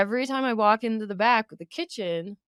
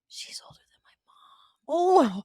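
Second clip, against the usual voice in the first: high, stretched stage whisper; slow, hushed mock disbelief; overheard kid; 7 semitones higher, slower than usual. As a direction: imitate a scandalized kid whispering. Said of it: "overheard the kid go".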